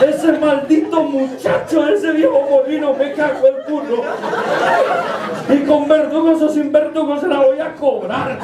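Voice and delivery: high-pitched, hammed-up tearful complaint; whiny wailing. Shaken envoy complaining to his king, whiny wailing. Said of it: "el enviado no hacía más que chillar".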